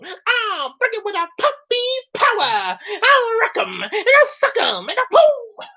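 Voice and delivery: very high, squeaky taunting voice with laughs; cocky cartoon monster. Monster Scrappy taunting Zachary, squeaky cocky voice. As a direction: taunt like a tiny cartoon menace.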